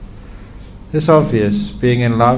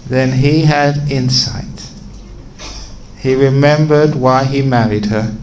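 ordinary talk, nothing marked

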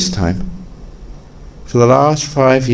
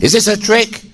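suspicious burglar; 11 semitones higher than usual. Acted.

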